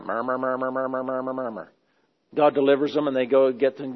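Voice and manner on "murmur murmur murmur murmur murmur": low, soft, mumbled grumbling; mock the Israelites' muttering complaint; grumbling murmur. Preacher mimics grumbling Israelites, low and soft mumble. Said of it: "But they kept murmuring".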